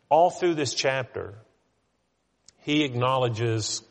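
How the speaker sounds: normal talking voice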